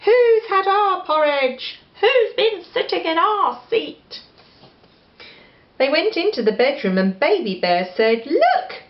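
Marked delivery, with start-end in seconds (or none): 0.0-4.2 high, indignant bear cry; high wailing bears; cross bear voice. A narrator's reading. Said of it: "who cried". 8.3-8.8 very high little-bear cry of discovery; high baby bear shout. A narrator's reading. Baby Bear spots Goldilocks.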